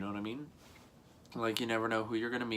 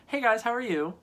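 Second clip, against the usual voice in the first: much higher, bright "Hey, guys"; happy greeting; 12 semitones higher, 4 dB louder than usual. Shown as a demonstration. Greeting viewers when happy, bubbly. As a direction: beam it out, pitched way up. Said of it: "if I'm happy".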